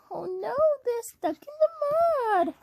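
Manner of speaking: high, quick mock dismay. Reader tells child about stuck trucks, dismayed. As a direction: worry aloud in a high voice.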